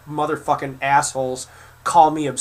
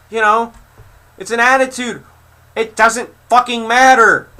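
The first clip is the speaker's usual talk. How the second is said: much higher and louder, yelling at the troll; real furious outburst, pitch way up; furious shouting; 7 semitones higher, 6 dB louder than usual. Real, not acted. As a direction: blow up over the wrong age, near screaming. Furious reply to age insult.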